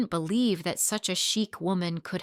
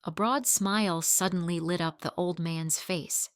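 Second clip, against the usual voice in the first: ordinary delivery, nothing marked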